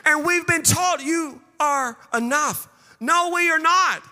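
high, loud, rhythmic sermon climax; full-force preaching. Acted. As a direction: build to a shouted, chant-like peak.

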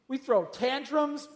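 ordinary talk

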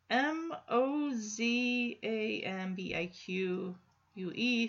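each letter slow and careful; slow spelling of M O Z A M B I Q U E. Shown as a demonstration. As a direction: sound out M O Z A M B I Q U E letter by letter. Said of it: "I mispronounced that".

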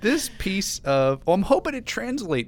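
high, loud, slow hype voice; enthusiastic announcer. Acted. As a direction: pump up the enthusiasm. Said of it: "My enthusiasm translates".

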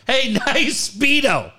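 high, slow mocking holler; imitated teasing yell. Son heckling water polo player, imitated teasing yell. Son's yelled taunt, acted in story.

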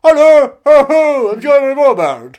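very loud, high hammed-up impression; put-on impression. Shown as a demonstration. Guest retrying his impression for hosts, shouted put-on voice. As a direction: boom out a big, high put-on voice. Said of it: "gonna try my impression again".

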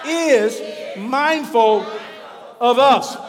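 very loud, slow, word by word; leading the response. Shown as a demonstration. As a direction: call the congregation to say it with you. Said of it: "Say it one more time".

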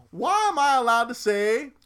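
high, loud mock protest; indignant retelling. Retelling a comedian's complaint to the network, indignant. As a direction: act out an outraged comic's complaint.

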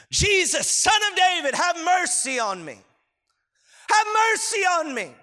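high, far-carrying beggar's cry; desperate shout. Acted. Blind beggar shouting to Jesus for mercy, high desperate shout. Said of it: "he begins to shout".

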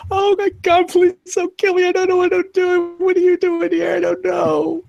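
very high, loud panicked squeal; terrified pleading. Acted. Startled worker begs the intruder not to kill him, terrified. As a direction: beg for your life in a shrieky high voice. Game master acts terrified, cornered man.

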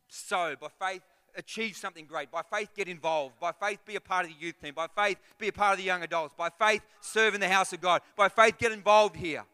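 high, loud driving sermon cry; preacher rousing the congregation; fiery preaching. Acted. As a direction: build and hammer each by faith. Preaching at full force.

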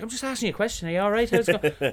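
high, loud friendly greeting; loud, high Irish small-talk opener; cheerful chat. Retold or imitated. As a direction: breeze in wanting a chat. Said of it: "But it's like".

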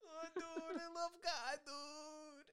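high, whimpering gush over Jesus; mock weepy devotion. Parodying the neighbor who found God, high weepy gush. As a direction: overdo a tearful born-again bro. Mocking the new convert's devotion.

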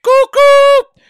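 high, loud whistle call; train whistle. Pretend. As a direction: hoot like a steam train.

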